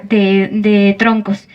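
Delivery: plain reading voice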